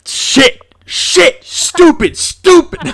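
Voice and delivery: very high, loud outburst; mock self-scolding. Game master berating himself over a forgotten word, comic high shouting. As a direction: yelp each word separately at yourself.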